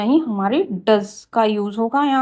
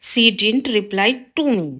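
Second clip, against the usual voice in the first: slow, careful read of the corrected sentence; slow teacher dictation; much slower than usual. Shown as a demonstration. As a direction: lay out each word clearly for the class. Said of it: "right होगा".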